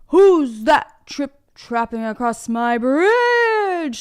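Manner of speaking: loud, slow storybook troll bellow; screaming troll. Acted. Troll challenges goat crossing his bridge, slow bellowing troll voice. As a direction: roar it out, drawing out the last word. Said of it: "screamed the troll".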